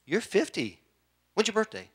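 usual speaking voice

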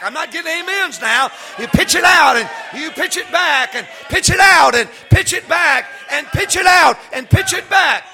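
high, loud, rhythmic shouted preaching; full-force preaching. Acted. Preacher rousing congregation to work together, full shouted preaching. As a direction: build the congregation up to a roar.

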